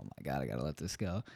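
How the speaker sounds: quick, hushed prayer under the breath; muttered prayer. Retold or imitated. Praying alone in his work truck, quick hushed mutter. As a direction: mumble it fast to God. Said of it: "So I start praying".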